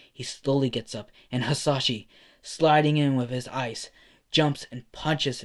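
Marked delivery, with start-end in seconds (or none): none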